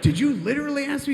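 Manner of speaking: very high, loud questioning; imitating an incredulous boss; outraged disbelief. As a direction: squeal the question in mock indignation.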